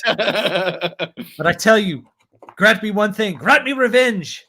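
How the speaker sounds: high, impassioned movie plea; Conan. Acted. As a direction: declaim it with rising fervour. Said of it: "And he goes".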